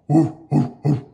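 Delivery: loud gruff dog barks; fierce barking. A narrator's reading. The fierce dog bursts in on the mice, barking. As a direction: bark it out like an angry dog. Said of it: "barked a fierce, angry voice".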